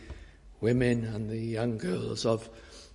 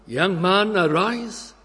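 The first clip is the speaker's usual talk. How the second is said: higher, louder summons to rise; fervent call; 7 semitones higher, 6 dB louder, slower than usual. Acted. Preacher summoning the young man, raised and fervent. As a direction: call them up with full force.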